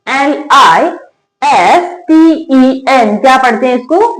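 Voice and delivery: normal talking voice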